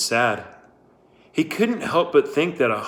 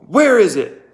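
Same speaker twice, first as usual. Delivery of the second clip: loud, high sharp demand; 9 semitones higher, 8 dB louder than usual. Acted. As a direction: snap the question at her. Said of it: "she demanded".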